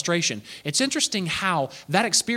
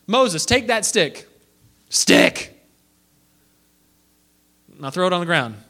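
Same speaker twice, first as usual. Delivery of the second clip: commanding God; 4 dB louder, slower than usual. Acted.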